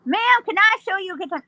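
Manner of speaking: high-pitched voice up in the sinuses; high nasal pitch. Retold or imitated. Cowboy offering the lady help, high and nasal. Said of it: "high, high pitch nasally up in his".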